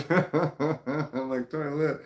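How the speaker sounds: laughing through a puzzled toilet; real laughter at her own confusion over the word. Real, not acted. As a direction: laugh while asking it back.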